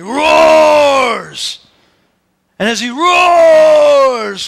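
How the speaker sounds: bellowing lion roar. Acted. Preacher acting a roaring lion.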